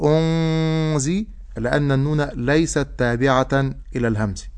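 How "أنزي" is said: أنزي very slow, nun hidden in nasal ghunna; correct ikhfa. Shown as a demonstration. Teacher modelling the correct hidden nun. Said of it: "فأقول".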